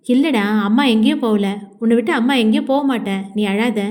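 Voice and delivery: usual speaking voice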